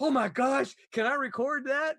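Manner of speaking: retelling a thrilled moment; excited. Retold or imitated. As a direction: gush with delight. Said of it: "And I'm like".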